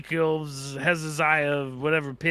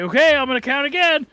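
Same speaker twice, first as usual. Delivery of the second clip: put-on high, louder sing-song to a child; playful to children; 11 semitones higher, 7 dB louder, faster than usual. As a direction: announce the next round to a little kid. Ghost hunter playing hide and seek.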